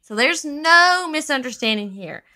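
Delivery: ordinary talk, nothing marked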